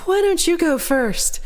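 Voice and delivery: high, slow, sugary offer; lightly hammed-up sweetness; mock-sweet offer. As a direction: play it overly polite to dodge the clerk. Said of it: "I was like".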